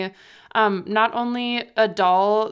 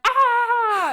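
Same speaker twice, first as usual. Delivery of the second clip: high, short hammed-up scream with hands on face; mock Home Alone scream; 13 semitones higher, 3 dB louder than usual. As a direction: squeal like the kid in Home Alone. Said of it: "with her hands on her face and went".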